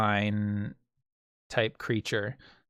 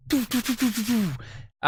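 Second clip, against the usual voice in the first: quick, high puffed pulses; string of short mouth-made pops; rapid-fire sound effect; 10 semitones higher than usual. Acted. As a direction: imitate a burst of distant shots. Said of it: "then you can hear".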